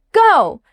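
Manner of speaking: "Go," starting high and dropping, firm; firm falling "Go,"; falling command tone. Shown as a demonstration. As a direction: drop "Go," firmly from a high pitch. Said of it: "your tone drops, short and firm".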